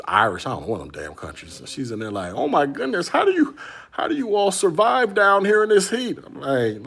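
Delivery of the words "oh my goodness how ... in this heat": high, loud imitation of a sunburnt Scottish tourist's accent; hammed-up Scottish accent, pitched way up; Scottish lady accent. Scottish tourist asking the store clerk about the heat, mock Scottish accent. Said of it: "she had, like, this heavy Scottish accent".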